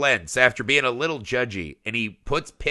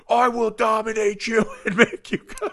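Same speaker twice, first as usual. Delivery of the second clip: higher, laughing mock menace; inner tyrant; 9 semitones higher than usual. Inner voice toward his son, laughing mock menace. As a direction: ham up a villain inside your head. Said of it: "on the inside, you're like".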